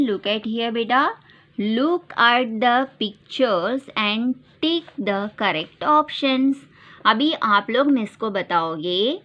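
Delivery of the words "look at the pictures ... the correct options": slow, deliberate reading; slow instruction. Shown as a demonstration. Teacher reading an exercise slowly to the class. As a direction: read the task slowly to children. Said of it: "Dear children".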